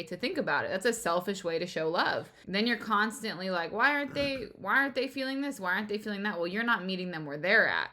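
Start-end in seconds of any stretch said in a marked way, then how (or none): 3.7-6.4 higher-pitched puzzled complaint; mock-frustrated questioning, pitched up; put-out, whiny-leaning plea for understanding; mildly exasperated. Voicing a frustrated partner's complaint.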